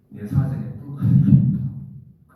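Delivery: put-on "내 사전에 불가능이 없다" drawn out slow and loud in a Gyeongsang accent; loud, slow Gyeongsang boast; Gyeongsang dialect. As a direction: bellow it like a brash Gyeongsang man. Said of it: "경상도 말로".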